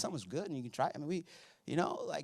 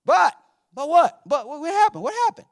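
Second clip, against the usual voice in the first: higher, louder, impatient "but what, what happened"; eager demanding crowd; 12 semitones higher, 8 dB louder than usual. Retold or imitated. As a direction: badger him to spill the news. Everyone begging to hear.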